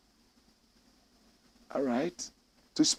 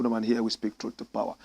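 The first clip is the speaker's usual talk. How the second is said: usual speaking voice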